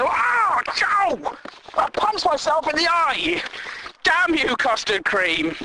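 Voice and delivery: mock pained yell. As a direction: yelp as if you just poked your own eye. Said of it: "you're like".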